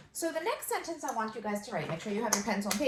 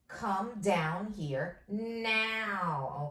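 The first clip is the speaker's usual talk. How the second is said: slow dictation; 3 dB louder, much slower than usual. Shown as a demonstration. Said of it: "dictating slowly".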